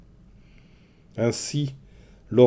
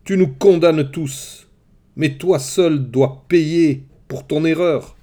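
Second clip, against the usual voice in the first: higher, louder and slow, each word hammered; furious accusation; 6 semitones higher, 6 dB louder, slower than usual. A narrator's reading. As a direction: spell out the blame in slow fury. Ulysses' violent rage at king.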